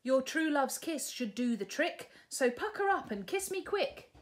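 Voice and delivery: princess. Acted. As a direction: brightly cut him off. Sue's voice.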